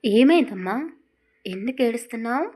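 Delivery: loud, fast, anxious asking; worried grandmother. Acted. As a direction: rush in alarmed for the girl. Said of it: "కంగారు పడ్డారు".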